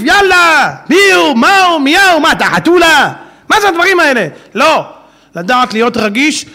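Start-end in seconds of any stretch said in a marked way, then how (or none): 0.0-4.3 loud, higher accusing barrage; harsh attack. Acted. Crowd tearing into a man who erred, angry loud. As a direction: fire the questions like a mob.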